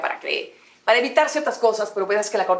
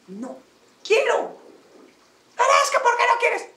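much higher, fast, insistent whine; mock pleading coworker; 13 semitones higher than usual. Coworker pressing to talk after being refused, high whiny plea. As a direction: whine like someone begging for a talk.

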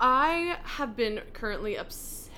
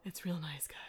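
soft mock-relaxed whisper; easy breezy whisper; 4 semitones lower, 9 dB quieter than usual. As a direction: breathe it out all chilled. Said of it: "you start talking like this".

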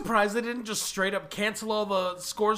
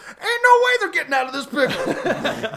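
high, hammed-up announcer; mock trailer voice; 7 semitones higher than usual. Parodying the film's ending as a promo, hammed-up announcer. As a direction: sell it like a cheesy promo. Movie trailer narrator riff.

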